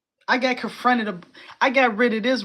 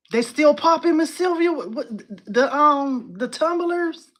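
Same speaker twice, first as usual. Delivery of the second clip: much higher and louder, bubbling over; excited outburst; 8 semitones higher, 6 dB louder than usual. Real, not acted. Thrilled by old tumblers.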